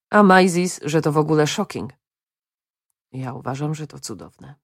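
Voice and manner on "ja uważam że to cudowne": hushed, much softer and lower; soft low murmur; whispered. A narrator's reading. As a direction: say it under your breath, just short of a whisper. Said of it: "wyszeptał Joachim".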